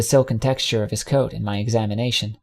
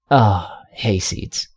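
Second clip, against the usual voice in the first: slow, low groggy mumble; just woken, still dizzy; 3 semitones lower, much slower than usual. A narrator's reading. Groggy stallion just woke.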